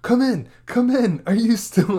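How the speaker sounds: loud, high calling over the radio; hammed-up sheriff radio call; mock radio call. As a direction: shout into the walkie. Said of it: "Sheriff Bruce is like".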